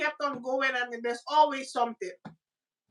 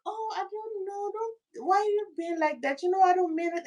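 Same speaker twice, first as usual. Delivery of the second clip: put-on soft, higher, babyish protest; meek pleading; 5 semitones higher, 3 dB quieter than usual. Woman soothing a man who is arguing, meek baby voice. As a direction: act small and innocent, soft and high.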